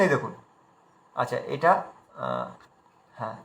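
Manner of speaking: normal talking voice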